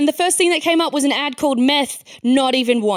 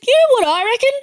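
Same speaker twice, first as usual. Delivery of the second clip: mock yokel bloke; 6 semitones higher than usual. Local bigot quoted at pub.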